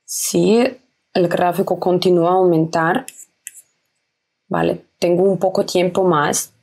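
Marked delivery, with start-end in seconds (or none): none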